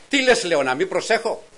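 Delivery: loud, high, fed-up comeback; exasperated retort. Retold or imitated. Husband snapping back at his nervous wife, loud and high. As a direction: shout back at her, high and indignant.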